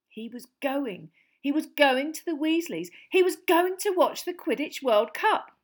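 building to high joy; excited. A narrator's reading. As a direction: let the joy grow. Harry's joy rising.

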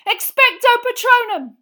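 very high, full-force yell. A narrator's reading. Harry blocking out the screaming, yelling. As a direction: yell the incantation with everything. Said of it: "Harry yelled".

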